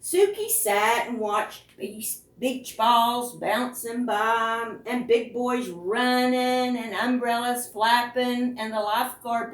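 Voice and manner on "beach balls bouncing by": ordinary delivery, nothing marked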